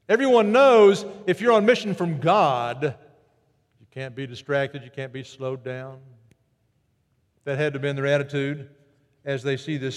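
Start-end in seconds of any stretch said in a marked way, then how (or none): 3.8-6.0 lower, much softer, intent; hushed insistence. Acted. Preacher hushing the congregation about the mission. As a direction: drop to a hush to stress it.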